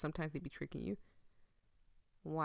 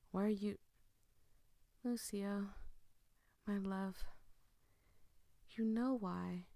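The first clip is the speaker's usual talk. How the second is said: halting, subdued voice with long pauses; grieving game character; 3 dB quieter than usual. Acted. Apologizing to lost love Lucio, grieving and subdued. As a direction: speak haltingly, weighed down by sorrow.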